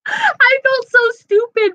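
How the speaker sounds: high laugh breaking into the words. Real, not acted. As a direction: laugh it out, embarrassed. Laughing at her own gushing.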